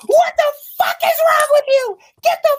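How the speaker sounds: high, loud screeching yell; shrieked parental outburst; ballistic mom. Retold or imitated. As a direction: scream it as a furious mother at the top of your range. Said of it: "she went ballistic".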